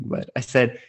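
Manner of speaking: normal talking voice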